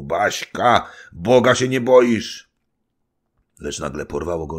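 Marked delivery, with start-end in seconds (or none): 0.0-2.5 loud scolding shout, rising high; angry cry. A narrator's reading. Husband rebukes his wife for coming, angry shout. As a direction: cry out in indignant reproach. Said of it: "On zaczął się w istocie gniewać, już zakrzyknął".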